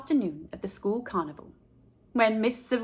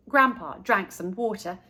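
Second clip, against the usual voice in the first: ordinary delivery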